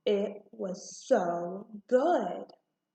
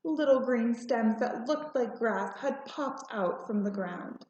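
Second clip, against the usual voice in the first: usual speaking voice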